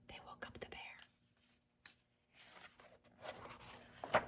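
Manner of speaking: fast hushed whisper after a gasp; gasp, then whisper in dread. A narrator's reading. Reading to children, gasping that the bear woke.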